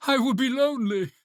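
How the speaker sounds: high, plaintive wail; high-pitched desolate call; shouted plea. A narrator's reading. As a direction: cry out fearing being left alone. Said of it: "he shouted".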